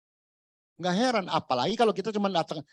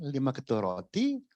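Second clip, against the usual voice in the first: normal talking voice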